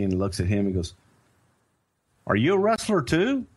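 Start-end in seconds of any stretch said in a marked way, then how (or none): 2.3-3.5 high-pitched questioning cop; curious cop. Retold or imitated. Cop shining the light on Dusty, curious cop. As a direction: ask it in a put-on cop's voice.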